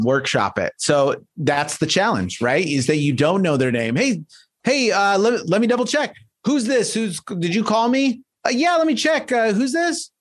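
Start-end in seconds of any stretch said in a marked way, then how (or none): 4.0-8.2 bright high phone voice; cheerful cold call; upbeat caller. Pretend. Role-play of a sales call. 8.4-10.1 high unsure phone voice; puzzled pickup; confused answerer. Pretend. Role-play answering the phone.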